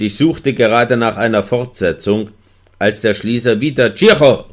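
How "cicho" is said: höher und lauter gerufen; Ruf. A narrator's reading. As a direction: knapp Ruhe befehlen. (Schließer ruft vom Hof, laut)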